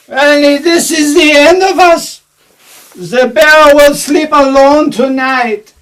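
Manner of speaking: wounded melodramatic Russian shout; dramatic Russian-accented outburst. Acted. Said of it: "Bloody drama queen".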